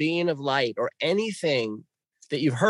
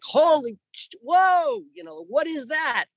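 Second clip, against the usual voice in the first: high, shocked yelp of surprise; reenacted fright at the owl; startled; 13 semitones higher than usual. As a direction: jump back in shock.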